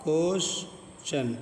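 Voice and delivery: क्वेश्चन stretched slowly with ch; slow question with ch. Shown as a demonstration. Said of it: "तो क्या बना".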